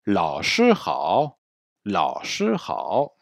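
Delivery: "Lao shi hao" at half speed, syllables spaced; slow model greeting. Shown as a demonstration. Teacher models the students' reply "Lao shi hao", slow. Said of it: "Bonjour professeur".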